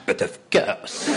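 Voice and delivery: slow, loud, hammed-up French accent. Actor proving to the director he can do French, French accent. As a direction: draw it out in a comic French accent. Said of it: "speak French with a French accent".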